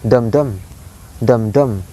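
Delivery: "dum dum" with both beats equally stressed; two equal stresses on each "dum dum"; spondaic beat. Shown as a demonstration. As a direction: beat out "dum dum" evenly, pause, repeat. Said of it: "the pattern here is like".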